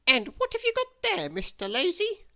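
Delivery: put-on little girl asking. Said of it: "asked little Miss Brainy".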